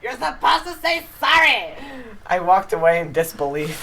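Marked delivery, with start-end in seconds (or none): 0.0-1.8 high, indignant mock scold; imitating an offended girl snapping; mock angry. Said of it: "really mad and said".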